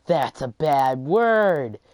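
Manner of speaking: loud, very slow, drawn-out imitation; exaggerated, stretched-out tattling; mock scandalized scolding. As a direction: mimic a kid calling out a swear. Imagined classmate scolding over swearing.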